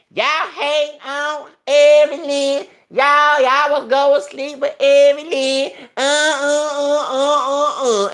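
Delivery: high, loud, drawn-out jeer; loud mocking rant. Real, not acted. Scolding the sisters about Evelyn, loud drawn-out mockery. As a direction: stretch it out high and loud, jeering.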